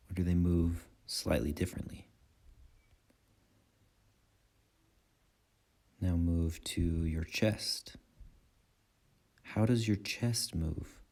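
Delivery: calm guiding voice in short phrases with long pauses; guided meditation. Acted. Meditation teacher guiding listeners' attention through breath and body, calm guided meditation. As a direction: lead them unhurriedly through the breath, leaving space after each phrase.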